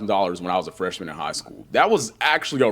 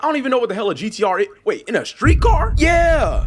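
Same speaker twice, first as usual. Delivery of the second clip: high-pitched retold astonishment, building louder; baffled uncle; 11 semitones higher, 5 dB louder than usual. Uncle hears of two-thousand-horsepower street cars, mock astonishment. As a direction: start quick, then stretch into loud disbelief. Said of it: "He'd be like".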